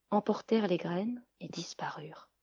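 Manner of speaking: whispered, fading; mysterious whisper. A narrator's reading. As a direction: hush as they vanish. Bras disparaissent. (chuchoté)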